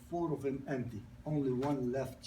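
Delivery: normal talking voice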